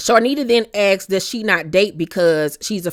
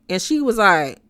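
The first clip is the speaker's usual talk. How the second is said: ordinary talk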